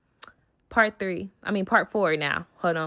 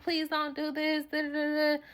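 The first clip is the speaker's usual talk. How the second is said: mock pleading; 7 semitones higher, 7 dB quieter than usual. Mimicking the friend's pleading.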